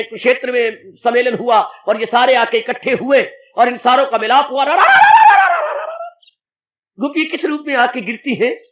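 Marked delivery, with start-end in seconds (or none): 4.6-6.0 very high, loud trilled "ra-ra-ra"; high rolling cry. Acted. Preacher picturing everyone meeting at Kurukshetra, high rolling cry. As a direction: let out a high rolling whoop that fades.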